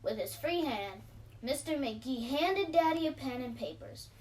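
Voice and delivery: usual speaking voice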